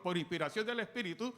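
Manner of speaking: ordinary delivery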